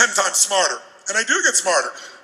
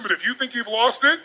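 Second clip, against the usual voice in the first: ordinary delivery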